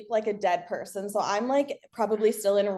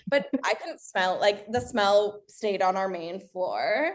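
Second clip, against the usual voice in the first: ordinary delivery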